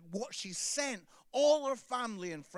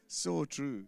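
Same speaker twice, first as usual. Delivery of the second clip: normal talking voice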